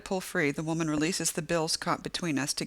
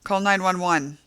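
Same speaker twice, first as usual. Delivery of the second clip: louder call to another room; shout; 3 semitones higher, 7 dB louder than usual. A narrator's reading. Narrator shouting to Barbara for help. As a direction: shout for help over your shoulder. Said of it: "turn to shout".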